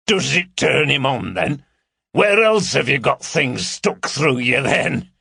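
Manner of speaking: lewd Cartwright. A narrator's reading. Cartwright teasing Carol about piercings, lewd. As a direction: tease her with coarse relish.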